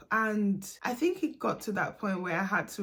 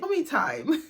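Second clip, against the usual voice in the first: playful announcement, breaking into a laugh, higher and louder; 7 semitones higher, 4 dB louder than usual. Real, not acted. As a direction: say it like a little treat, laughing.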